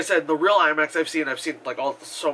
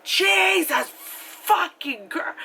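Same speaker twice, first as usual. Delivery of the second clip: high, drawn-out mock yell; overwhelmed exclamation; 13 semitones higher, slower than usual. Moviegoer hit by cranked-up IMAX sound, mock overwhelmed yell. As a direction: cry out as if blasted by noise.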